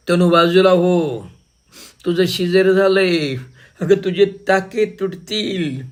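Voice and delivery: loud urgent cry to stop her daughter; retold shout. Mother shouting at daughter to stay away, loud alarm. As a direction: yell it out in alarm, then ease. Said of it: "मी ओरडले".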